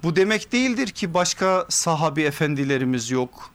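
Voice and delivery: usual speaking voice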